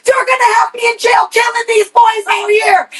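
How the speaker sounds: frantic shouted dad; dad shouting. Retold or imitated. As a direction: bellow it like a panicked father. Dad scolding after chase.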